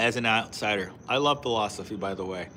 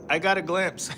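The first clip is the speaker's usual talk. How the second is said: ordinary talk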